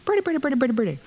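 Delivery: put-on high cooing baby talk. A narrator's reading. Mother cooing to the little girl, high baby talk. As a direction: coo at the child like to a baby. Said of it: "starts talking baby talk".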